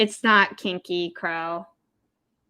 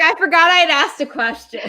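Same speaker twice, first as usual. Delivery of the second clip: laughing quote; 7 semitones higher, 7 dB louder, faster than usual. Retold or imitated. Laughing at her own forgetfulness.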